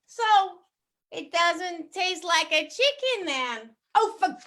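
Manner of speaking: put-on crazy American accent. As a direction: read the pigeon story in an over-the-top American accent. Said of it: "I used to do it with a very crazy American accent".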